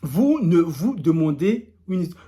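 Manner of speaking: slow, deliberate read-aloud; careful re-reading. Shown as a demonstration. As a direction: read it out slowly, word by word. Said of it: "on va relire posément". (lecture posée, lente)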